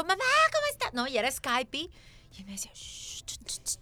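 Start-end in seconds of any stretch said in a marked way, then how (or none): 0.0-0.9 very high, bright call to mom, louder; effusive greeting. Retold or imitated. Daughter calling her mom on Skype, loud effusive greeting. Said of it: "que era efusivo". 2.7-3.8 whispered impatient shush; imitated shushing. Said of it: "Y se desesperaba".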